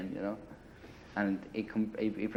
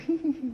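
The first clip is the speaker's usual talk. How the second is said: imitated giggle; 5 dB louder than usual. Imitating the policeman giggling over his tea. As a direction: mimic a high, loud little giggle. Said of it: "He starts giggling".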